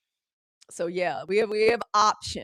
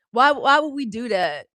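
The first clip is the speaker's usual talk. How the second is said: normal talking voice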